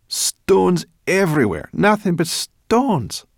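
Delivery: uneasy. Acted. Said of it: "looked uneasily".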